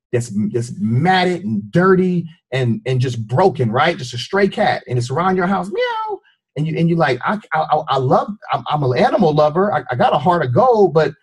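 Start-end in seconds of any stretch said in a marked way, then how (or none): 5.7-6.2 very high, thin meow; cat meow. Retold or imitated. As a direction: mew like a small stray cat. Imitating a stray cat.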